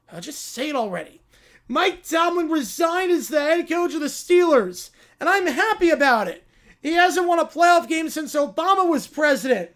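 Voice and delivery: put-on pouty bratty child, complaining about everything; whiny spoiled kid. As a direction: play a spoiled kid sulking. Whiny spoiled kid character bit.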